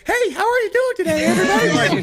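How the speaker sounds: mock cheery, very high greeting. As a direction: put on an absurdly high, loud hello. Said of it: "like, a higher pitched voice".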